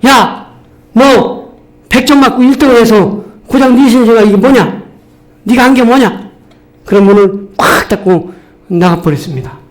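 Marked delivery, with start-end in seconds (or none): 0.1-6.3 wife snapping back, raised and higher; fed-up wife lashing out at her husband; angry wife. Retold or imitated. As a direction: throw the door open and let him have it. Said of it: "이 부인이 화가 나가지고는 문을 확 열고는".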